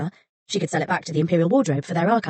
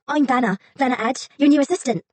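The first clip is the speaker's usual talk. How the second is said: higher, eager self-introduction; bright introduction; 7 semitones higher than usual. Acted. Assistant introducing herself to the scholar, cheerfully high. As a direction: beam as you give your name.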